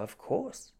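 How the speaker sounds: high, breathy-free gushing praise; mock delight over the show; lightly mocking enthusiasm; gushing fan. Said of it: "And they go".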